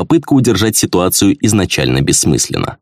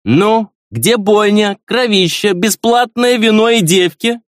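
put-on high, slow, slurred drunk prince; sway and slur like a drunk noble; slower than usual. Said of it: "пьяный голос".

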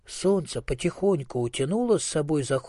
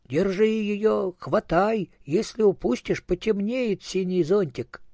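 urgent calling out; 7 semitones higher than usual. A narrator's reading. Хвостик urging cucumber to catch the melon, calling out. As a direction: call out in alarm without full shouting. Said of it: "кричал Хвостик".

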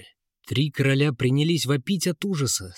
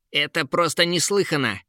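higher, mildly barking indignation; barked outrage; 5 semitones higher than usual. Acted. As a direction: bark it like an offended king. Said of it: "рявкнул Кейн". (возмущённо рявкнуть)

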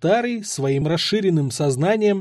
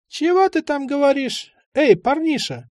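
put-on a higher, croaky-free old man calling out; old man's voice; 11 semitones higher than usual. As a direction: voice the old stoner asking back.

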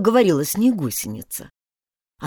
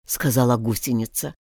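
usual speaking voice

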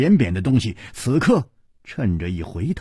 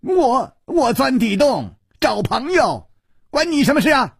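high, stammering mock roar; 11 semitones higher than usual. Xue snapping back at Chu, high stammering yell. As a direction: bark it up high like a cornered child. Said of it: "吼道".

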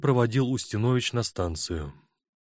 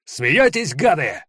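sudden high furious shout; 6 semitones higher, 6 dB louder, slower than usual. Acted. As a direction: spit it out at the crowd. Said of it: "он сел и выкрикнул".

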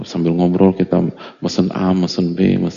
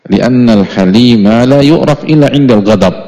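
loud, raised recitation of Arabic; declaimed Arabic; 4 semitones higher, 7 dB louder than usual. Acted. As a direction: proclaim the Arabic line to the hall. Arabic quote declaimed loudly.

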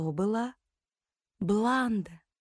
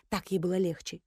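plain reading voice

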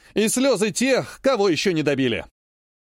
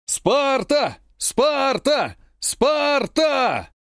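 chanted war cry, much higher, rising to a shout; battle cry; 9 semitones higher, much slower than usual. Acted. Soldiers answer their commander with a shouted war chant. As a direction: hundreds of soldiers roaring back. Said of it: "В едином боевом кличе".

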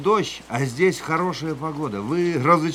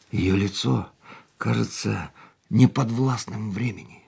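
inner narration; 6 semitones lower than usual. A narrator's reading. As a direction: muse on her face, unhurried.

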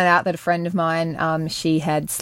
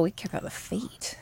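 whispering; 14 dB quieter than usual. Real, not acted. Conspiratorial aside about feet.

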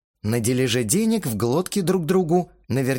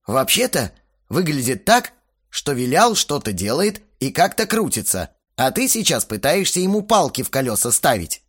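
ordinary delivery, nothing marked

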